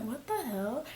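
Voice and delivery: softly muttered question; stunned disbelief. Retold or imitated. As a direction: say it under the breath, baffled. Stunned reply to shrieking friend.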